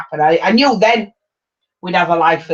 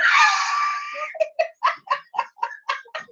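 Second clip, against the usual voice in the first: high burst of laughter; 5 dB quieter than usual. Real, not acted. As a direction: shriek with laughter. Big laugh at own joke.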